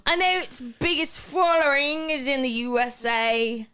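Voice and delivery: put-on clumsy Irish accent, slowing down on "Golden Globes"; bad Irish accent. Reading out a fan's question in a mangled Irish brogue. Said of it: "sounds like Mrs. Doubtfire".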